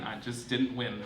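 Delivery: ordinary delivery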